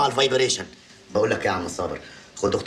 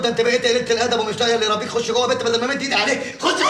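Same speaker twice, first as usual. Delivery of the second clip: fast, high, raised-voice telling off; angry scolding; 6 semitones higher, 3 dB louder than usual. Acted. Father orders his daughter inside, fast and angry. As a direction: threaten her and send her inside.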